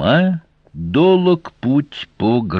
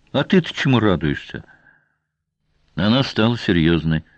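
ordinary delivery

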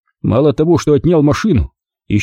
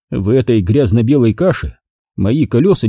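ordinary delivery, nothing marked

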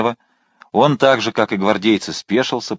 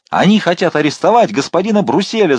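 higher, louder alarm to the crowd; shout; 8 semitones higher, 4 dB louder than usual. A narrator's reading. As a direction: rouse the crowd. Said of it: "закричал он". (кричит толпе)

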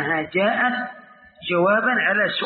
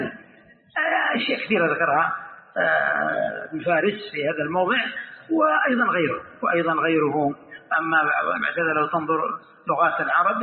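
ordinary talk, nothing marked

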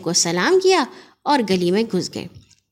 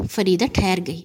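plain reading voice

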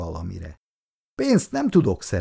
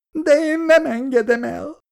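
high-pitched tearful wail; crying out; 3 dB louder than usual. A narrator's reading. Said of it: "sírt fel Szabó néni".

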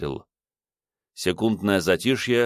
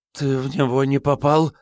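stammering fearfully; 6 semitones higher than usual. A narrator's reading. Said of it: "спросил заплетающимся языком".

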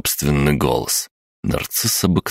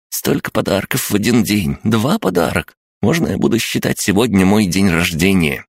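high, chirpy little creature voice; chirping creature; 6 semitones higher than usual. Acted. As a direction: chirp it like an excited gremlin. Crab-gnome speaks excitedly. (писклявое щебетание крабогнома)

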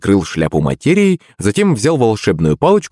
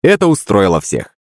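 usual speaking voice

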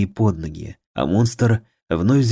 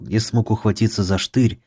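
ordinary delivery

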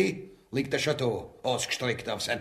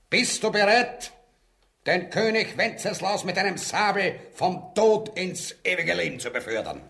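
loud, higher rallying demand; rousing challenge; 6 semitones higher, 3 dB louder than usual. Acted. Conspirator loudly challenges the captain to kill the king. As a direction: rouse him to the deed. Leader rallies captain to murder.

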